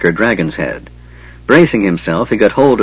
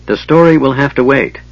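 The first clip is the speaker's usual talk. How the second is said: firmly; 5 semitones higher than usual. Acted. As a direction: shut it down with authority. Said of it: "Tanis said firmly".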